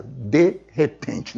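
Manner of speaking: de repente slowly, as two distinct words; slow, split "de ... repente"; de repente separated. Shown as a demonstration. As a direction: set DE apart from REPENTE in "de repente". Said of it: "Sempre separado".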